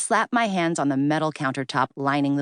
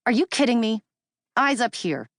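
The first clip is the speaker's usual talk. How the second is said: higher, pointed and offended; indignant demand; 7 semitones higher than usual. Acted. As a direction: scold him to look at your face. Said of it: "my demand".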